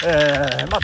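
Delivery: normal talking voice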